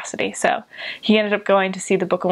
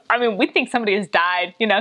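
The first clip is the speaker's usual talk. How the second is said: usual speaking voice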